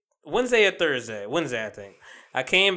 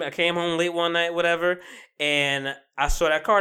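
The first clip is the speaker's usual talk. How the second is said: ordinary talk, nothing marked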